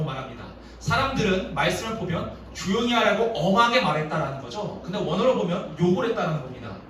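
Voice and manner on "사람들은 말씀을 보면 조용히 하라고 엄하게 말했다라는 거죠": normal talking voice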